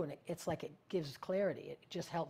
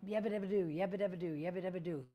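run-on nonsense babble, easing down in pitch; demonstrated babbling; 2 semitones higher, faster than usual. Shown as a demonstration. Showing listeners how to get the mouth in gear, babbling. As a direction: let the mouth rattle off nonsense syllables. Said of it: "just start babbling".

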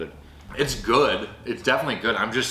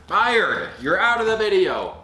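slow then loud mock firing; mock stern scolding; 11 semitones higher, 7 dB louder, slower than usual. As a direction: playfully scold the dog, then shout. Scolding the dog for licking chip.

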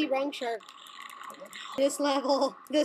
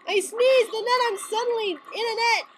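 ordinary delivery

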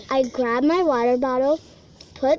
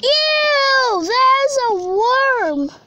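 grossed out; 9 semitones higher, 4 dB louder, much slower than usual. Real, not acted. Finds a worm in backyard.

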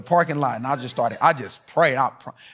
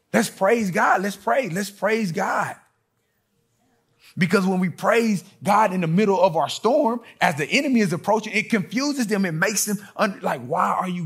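preaching at full force; 6 semitones higher than usual. Acted. Preacher rallying the congregation, full force. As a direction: lift the church into praise.

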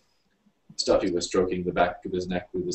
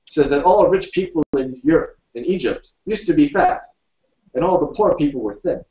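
high, loud character voice; insistent storybook character, pitched up; William making his argument; 9 semitones higher, 7 dB louder than usual. A narrator's reading. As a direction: argue the point in a raised, emphatic voice.

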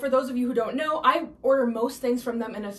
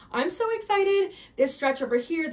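much higher and louder, bursting with joy; squealing excitement; 5 semitones higher, 4 dB louder than usual. Real, not acted. Bursting with excitement over dress.

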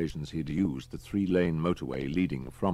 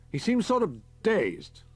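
captain voice; 14 semitones higher, 5 dB louder than usual. A narrator's reading. Captain Peters on radio.

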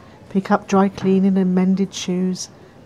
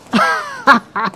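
short, higher-pitched laugh; scoffing laugh; 3 semitones higher, 4 dB louder than usual. Acted. Customer sure the cone choice won't fluster them, scoffing laugh. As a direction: laugh it off with bravado.

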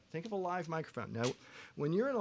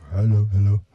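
loud "Hello, hello" shouted too close to the mic; loud mic-check shout; 7 semitones lower, 20 dB louder than usual. Shown as a demonstration. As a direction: bark "Hello, hello" straight into the mic. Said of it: "if you're".

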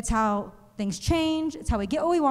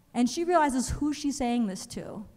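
ordinary talk, nothing marked

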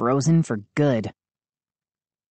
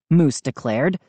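ordinary delivery, nothing marked

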